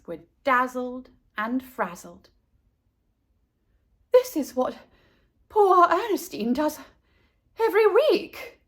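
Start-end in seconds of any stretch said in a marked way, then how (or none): 4.1-8.6 panting. Acted. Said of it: "Missus Buckmeister panted".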